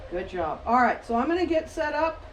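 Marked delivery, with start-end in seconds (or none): 0.1-0.6 soft praise. Real, not acted.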